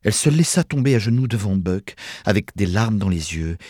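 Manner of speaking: plain reading voice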